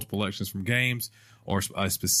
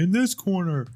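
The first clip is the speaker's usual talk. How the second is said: high, loud ring announcer call; hype man voice; 10 semitones higher, 4 dB louder than usual. Shown as a demonstration. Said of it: "in a hype man voice".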